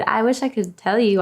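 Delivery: ordinary delivery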